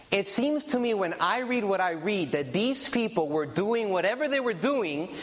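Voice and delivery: ordinary talk, nothing marked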